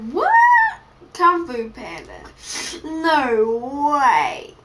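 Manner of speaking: re-enacted astonished reaction; acted-out disbelief. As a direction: play up the amazed discovery. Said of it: "it was like".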